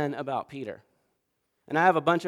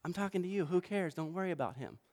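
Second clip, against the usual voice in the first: 1 gently dismissive Jesus; 12 dB quieter than usual. Retold or imitated. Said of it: "And Jesus is like".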